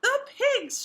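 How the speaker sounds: high, loud shout. Acted. Bert telling Ethel the pigs escaped, shouting. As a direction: yell it out in alarm. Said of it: "shouted Bert".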